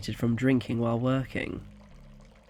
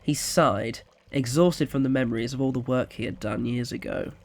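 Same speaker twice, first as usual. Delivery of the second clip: ordinary delivery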